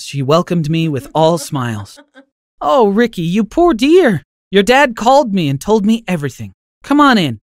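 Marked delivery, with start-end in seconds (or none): 2.6-7.4 grandma welcoming her grandson in; tender grandma. Acted. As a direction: comfort him like a kind old grandmother. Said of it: "she said".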